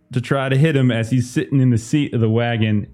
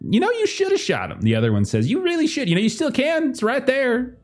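much higher, faster yokel voice; 12 semitones higher, faster than usual. Acted. Second farmer eggs on his partner in a high yokel voice. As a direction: pipe up like a dim second farmer.

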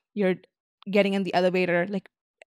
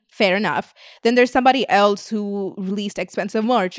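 ordinary talk, nothing marked

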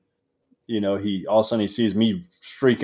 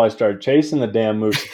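ordinary delivery

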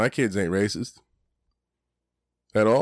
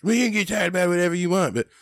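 much higher, fast bratty cartoon voice; Cartman impression; 10 semitones higher than usual. Retelling South Park, high bratty Cartman voice. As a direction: snap back like a bratty cartoon kid. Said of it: "And then he's like".

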